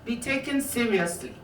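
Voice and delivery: calm, even reading from a memoir; measured author reading; book reading. Acted. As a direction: read the passage steadily to the audience. Reads aloud from her book.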